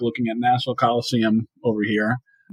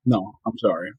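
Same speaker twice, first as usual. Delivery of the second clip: ordinary talk, nothing marked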